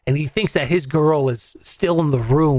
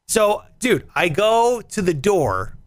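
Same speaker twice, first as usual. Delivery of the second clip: ordinary talk, nothing marked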